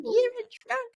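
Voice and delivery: very high squeaky mock of the line; mocking Shannon. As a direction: parody her cruel bluntness in a shrill falsetto. Mocking Shannon's blunt "you're drunk.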